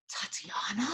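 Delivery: whispered, rising disbelieving question; hushed, astonished whisper; stunned whisper. Retold or imitated. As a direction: breathe the name in shocked disbelief. Expressive husband's stunned reaction.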